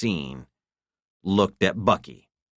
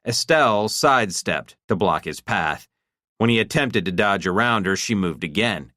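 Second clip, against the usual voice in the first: ordinary delivery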